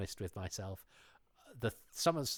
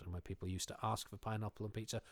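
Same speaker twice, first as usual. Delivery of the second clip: normal talking voice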